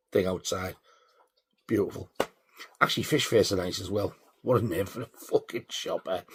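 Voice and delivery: ordinary delivery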